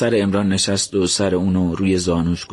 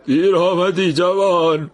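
slow grieving man; 10 semitones higher, 3 dB louder, slower than usual. Acted. Grieving man answers Imran.

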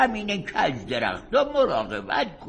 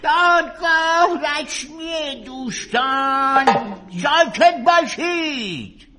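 calling for order; 3 semitones higher than usual. Acted. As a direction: bring the room to order.

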